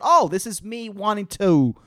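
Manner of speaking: normal talking voice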